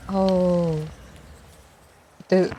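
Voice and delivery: ordinary talk, nothing marked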